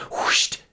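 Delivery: short, forceful airy whoosh; sudden gust whoosh. A narrator's reading. Winds hitting Havistraw Bay, sudden whoosh. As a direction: blast it out like a gust. Narrator imitates gust of wind.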